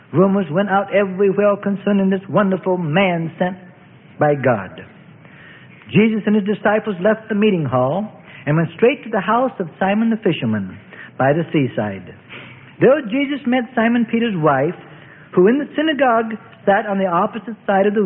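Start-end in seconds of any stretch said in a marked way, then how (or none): none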